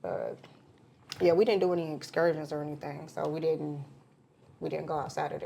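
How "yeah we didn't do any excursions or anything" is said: ordinary talk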